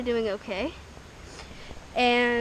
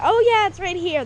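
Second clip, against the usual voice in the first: higher and louder, a bright "found it"; sudden discovery; 8 semitones higher, 6 dB louder than usual. Real, not acted. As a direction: pop up with surprise. Suddenly finds the tire.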